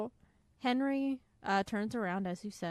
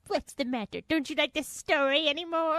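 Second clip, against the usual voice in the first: louder, higher mock Henry; imitating Henry voice; 7 semitones higher, 8 dB louder than usual. Mimicking her cohost's Henry, louder and higher. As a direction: ham up the hurt showman. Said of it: "That's a great Henry voice".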